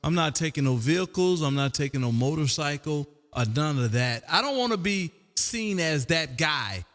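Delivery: ordinary talk, nothing marked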